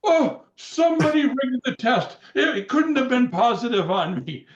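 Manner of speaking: imitating the Iron Sheik. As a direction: play the Sheik crying foul. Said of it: "He goes".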